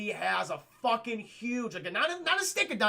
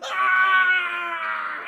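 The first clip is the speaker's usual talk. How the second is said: high, very loud drawn-out scream; hammed-up horror-movie scream; long agonized mock scream; 11 semitones higher, 11 dB louder than usual. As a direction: scream as if losing both arms. Acts out scream at bitten arms.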